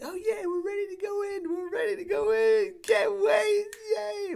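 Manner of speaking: put-on very high squealing little girl, loud and giddy; cartoon kid ready to go in; squeaky kid voice yelling with joy; excited daughter. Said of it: "my daughter's like".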